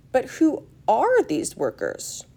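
slow, high, emphatic question; curious child character. Acted. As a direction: ask it slowly with puzzled emphasis.

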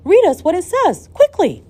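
high, loud, eager demand; urgent excitement. A narrator's reading. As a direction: urge them on impatiently. Grandfather urgently demands the news.

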